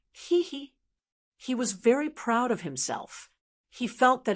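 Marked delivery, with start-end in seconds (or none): 0.1-0.7 high, lightly acted hee-hee chuckle; light giggle. A narrator's reading. Gao Yi pleased with the benefits of joining, light giggle. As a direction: narrate a small, pleased giggle. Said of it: "Gao Yi laughed".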